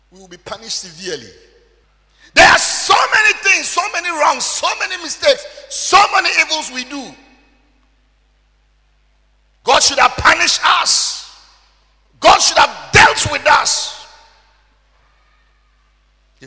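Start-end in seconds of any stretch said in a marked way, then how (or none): 2.3-14.1 full-force preaching. Acted. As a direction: thunder the scripture at the congregation. Preaching on sins.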